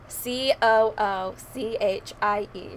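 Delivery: slow, letter by letter; deliberate, measured spelling. Real, not acted. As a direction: spell it out slowly, each letter separate. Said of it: "should I just spell it out?".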